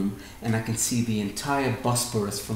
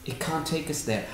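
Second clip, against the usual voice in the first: repeated much softer, sinking away; softer echo; 2 semitones higher, 7 dB quieter than usual. Shown as a demonstration. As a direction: let the repeat fall quiet like an afterthought.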